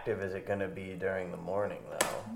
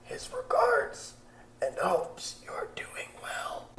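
put-on voice; 8 semitones higher, much slower than usual. Player in a put-on voice.

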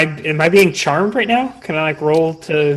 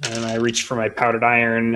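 ordinary talk

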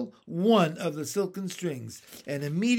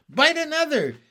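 raised, commanding order; sharp order; 9 semitones higher, 6 dB louder than usual. A narrator's reading. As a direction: bark the order to bite. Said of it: "James ordered".

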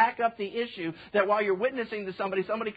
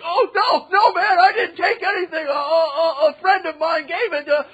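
much higher, shouted frantic pleading; panicked denial; 11 semitones higher, 12 dB louder than usual. Retold or imitated. Thief caught red-handed, shouting panicked denials. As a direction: beg off in a terrified yelp. Thieves protesting when grabbed.

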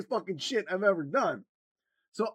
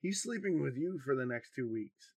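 cold; 5 semitones lower, 6 dB quieter than usual. Retold or imitated. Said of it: "She got dead cold".

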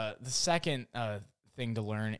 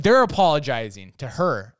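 normal talking voice

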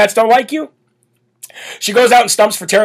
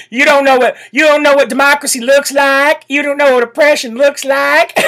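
higher, shouted mock AOC; mimicking AOC; 6 semitones higher than usual. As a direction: imitate her pandering yell. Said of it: "when A O C was like".

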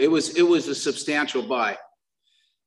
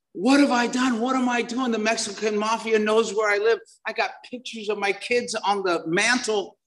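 high-pitched replayed panic; retold meltdown; 7 semitones higher than usual. Man in psychotic meltdown after drug deal, high replayed panic. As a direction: replay the terror, pitched up, half-acted. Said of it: "I am absolutely in meltdown".

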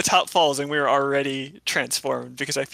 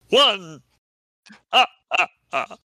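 stupid vampire voice; 5 semitones higher, 5 dB louder than usual. Acted.

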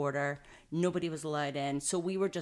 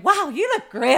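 mock impressed; 11 semitones higher, 14 dB louder than usual. Nurses' compliment quoted.

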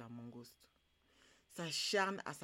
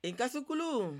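loud, high chanted call-out; ringing call, high then falling; storyteller's call; 6 semitones higher, 7 dB louder than usual. Shown as a demonstration. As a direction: call out to the audience for their response. Storyteller's call for audience response.

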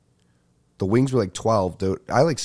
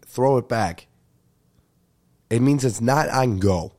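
ordinary talk, nothing marked

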